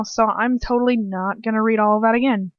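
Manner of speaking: plain reading voice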